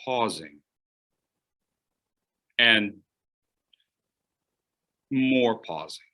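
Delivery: long pauses between words; pausing demo. Shown as a demonstration. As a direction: leave gaps around pausing. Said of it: "We use pausing to bring attention".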